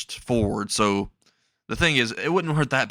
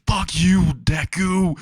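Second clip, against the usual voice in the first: higher, louder, drawn-out curse; mock angry yell; 7 semitones higher, 4 dB louder, much slower than usual. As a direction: hurl the insult at a rival. Trapped Bakugo curses at Deku.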